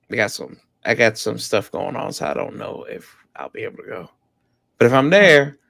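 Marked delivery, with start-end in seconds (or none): 2.1-4.1 soft whispered aside; whispering. Real, not acted. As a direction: drop to a whisper. Trailing off quietly.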